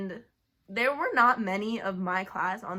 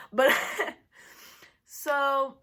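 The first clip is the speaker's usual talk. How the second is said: laughing through tears; 4 semitones higher, 4 dB louder than usual. Real, not acted. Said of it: "I knew I was gonna cry".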